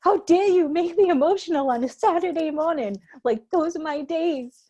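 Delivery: high, loud, hammed-up indignation; mock indignant. Complaining to a friend about a sad movie, high hammed-up indignation. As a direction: scold the movie for ruining your weekend. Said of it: "I was like".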